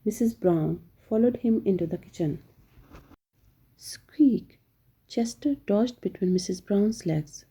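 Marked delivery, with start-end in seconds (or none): 3.8-4.6 higher squeak in another voice; mouse squeak. A narrator's reading. Chester dodging between Missus Brown's legs, squeaky mouse squeak.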